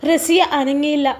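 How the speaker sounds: ordinary delivery, nothing marked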